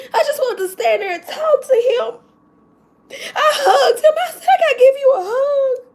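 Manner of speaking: high, tearful laughing sobs; overjoyed and breaking down; happy crying. Real, not acted. Teacher overjoyed, sobbing as she tells about hugging him. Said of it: "I'm so happy today".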